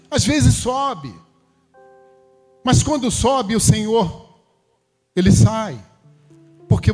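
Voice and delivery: high-pitched, emphatic sermon delivery; impassioned preaching. Real, not acted. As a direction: preach it high, drawing out the key words. Preaches with raised voice.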